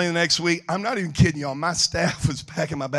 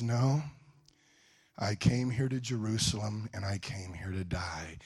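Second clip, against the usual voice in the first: very quiet, low, measured reply; solemn resolve; 7 semitones lower, 13 dB quieter than usual. Retold or imitated. Jesus answers disciples about his death, solemn and soft. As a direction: let it sink down softly and gravely.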